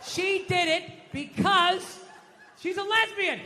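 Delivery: high, loud, stretched “because”; slow, emphatic yell; triumphant shouted revelation. Acted. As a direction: proclaim the answer at full voice. Shouting the same answer out.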